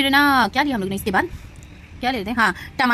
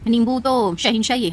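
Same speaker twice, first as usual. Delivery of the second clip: normal talking voice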